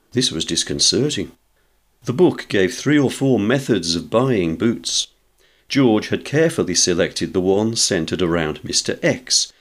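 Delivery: ordinary delivery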